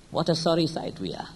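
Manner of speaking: ordinary talk, nothing marked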